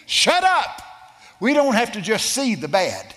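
sharp high loud shut up; shouted rebuke. Retold or imitated. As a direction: bark it out at your friend. Retold rebuke in trance.

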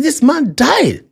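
high-pitched, emphatic disbelief; incredulous protest. Retold or imitated. Someone objecting to Jesus about the dead man, incredulous. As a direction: protest it high, hitting each fact.

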